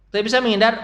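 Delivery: usual speaking voice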